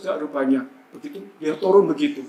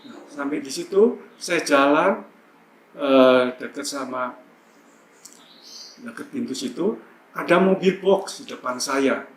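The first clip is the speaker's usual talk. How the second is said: ordinary delivery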